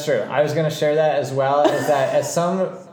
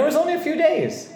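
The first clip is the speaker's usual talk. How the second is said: suddenly much higher, laughing; voice jumping high. Real, not acted. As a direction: voice flies up high. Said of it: "Why, I don't know why my voice went that high".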